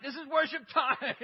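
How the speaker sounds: high, loud mock yell with a laugh; angry shout. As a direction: yell it half laughing at yourself. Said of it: "I'd be so angry".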